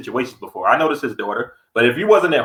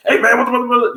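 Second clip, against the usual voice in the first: mock angry outburst; 12 semitones higher, 3 dB louder, faster than usual. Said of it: "But if you like".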